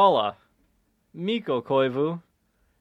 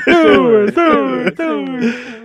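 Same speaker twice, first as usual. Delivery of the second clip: arena echo; 11 semitones higher, 10 dB louder, slower than usual. Pretend. Echoing announcer name.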